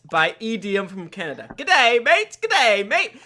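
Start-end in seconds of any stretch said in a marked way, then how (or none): 1.6-2.4 loud, high hammed-up Australian g'day; playful Australian accent; mock Aussie greeting. 2.4-3.2 loud, high hammed-up Australian g'day; playful Australian accent; mock Aussie greeting.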